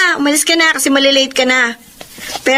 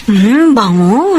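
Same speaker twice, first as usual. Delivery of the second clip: savoring sniff; 3 semitones lower, 4 dB louder, much slower than usual. Acted. Neighbor smells fried chicken.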